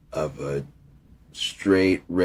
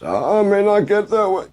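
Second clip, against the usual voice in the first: much higher, louder preacher impression; Martin Luther King voice; 8 dB louder than usual. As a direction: boom it out like a civil rights orator from the pulpit. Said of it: "I'm gonna do the Martin Luther King voice".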